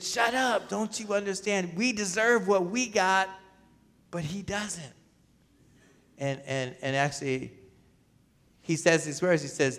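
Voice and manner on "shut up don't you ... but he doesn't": retelling the thief's rebuke, pitched higher; sharp rebuke. Retold or imitated. A preacher voicing the thief rebuking the other criminal, higher pitched. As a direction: snap the rebuke up high, then let it fall away. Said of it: "he said, he said".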